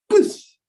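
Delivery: sharp high whoosh of a jet rushing past; whoosh sound. Retold or imitated. Pilot telling the congregation, mimicking the near-miss whoosh. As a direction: blow out the rush of the passing plane.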